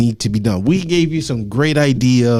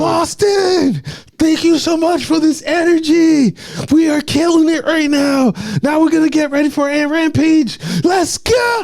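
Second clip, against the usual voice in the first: very high-pitched hyped-up imitation; mock an emotional hype man squealing to the crowd; strained falsetto announcer, peaking at the end; voice climbing higher; 13 semitones higher than usual. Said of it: "his voice go higher and higher in octave".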